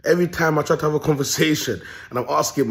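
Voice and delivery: ordinary delivery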